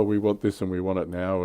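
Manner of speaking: normal talking voice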